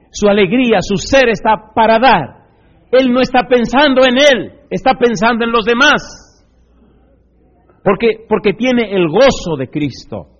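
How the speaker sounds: loud, high fervent preaching. Acted. Preacher urging the church toward joy in service, loud. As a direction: proclaim it with full fire.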